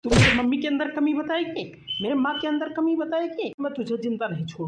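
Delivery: high, loud furious scolding; raging at the wife for blaming his mother; enraged yelling. Acted. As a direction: scream the accusation. Son enraged at wife over mother.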